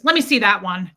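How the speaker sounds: loud, curt demand; rude and demanding. Retold or imitated. Overpowering customer orders the clerk, rude and demanding. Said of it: "just demanding".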